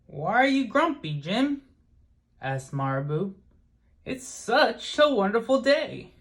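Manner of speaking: bright puzzled question, pitched well up; lightly voiced Marabou, high and curious. A narrator's reading. Marabou questions grumpy Jim.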